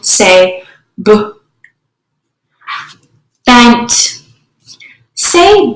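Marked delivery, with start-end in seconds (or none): 1.0-1.1 "b" as a short "buh"; just the stop sound "b"; isolated phoneme. Shown as a demonstration. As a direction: sound out "b", not "bee". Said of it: "substituting phonemes".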